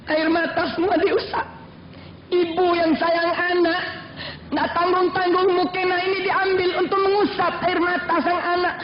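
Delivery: ordinary delivery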